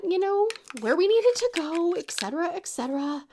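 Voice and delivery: usual speaking voice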